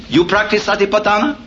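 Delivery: a hint of a surprised monk asking; monk's voice. Monk suddenly speaks to him.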